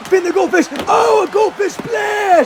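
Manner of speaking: high, slow shouted call; excited shout. Acted. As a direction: hype the splash at full voice. Calling the finishing move.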